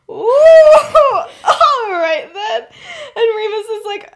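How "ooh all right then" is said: loud, high, drawn-out ooh; big hammed-up crowd reaction; mock-awkward group reaction. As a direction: tease it out loudly and slowly, half laughing.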